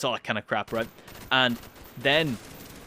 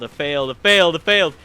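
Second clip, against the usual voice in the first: building panic; 4 semitones higher, 8 dB louder than usual. Retold or imitated. Repeated inner panic, building.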